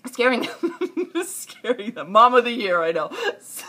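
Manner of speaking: laughing through the words, high and loud; real laughter. Real, not acted. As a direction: crack up while trying to tell it. Cracking up at own story.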